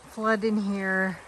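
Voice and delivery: usual speaking voice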